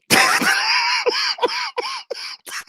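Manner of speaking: high, long burst of laughter; high wheezing laugh; helpless laughter. Real, not acted. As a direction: crack up at your own story. Cracks up at own story.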